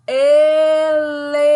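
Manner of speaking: elefante drawn out loud; slow elefante. Shown as a demonstration. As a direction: stretch elefante slowly and loudly. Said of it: "é o".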